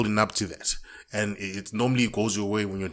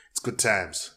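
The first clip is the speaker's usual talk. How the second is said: usual speaking voice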